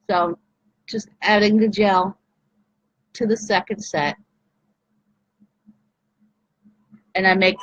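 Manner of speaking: mascara face, mouth propped open. Real, not acted. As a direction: talk with mouth hanging open. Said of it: "I make the mascara face".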